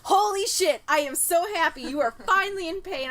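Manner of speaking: high, loud gleeful exclaim; mock delight at her pain; gleeful artist. Artist finally seeing his client hurt, high and loud.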